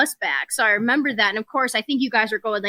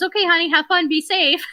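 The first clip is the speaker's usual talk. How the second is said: higher, breezy motherly sign-off; lightly voiced mom; 7 semitones higher than usual. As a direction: imitate an unworried mom saying goodbye. Voices her mom signing off.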